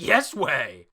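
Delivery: playful mock yell; insistent comeback. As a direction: fire it back like a stubborn sibling. Voicing Ulti's insistent comeback.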